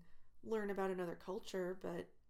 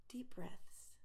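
calming whisper; 3 semitones higher than usual. Acted. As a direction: breathe it out to calm them.

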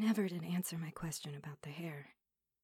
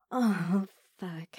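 aroused gasp; 10 dB louder than usual. Acted. Aroused outburst.

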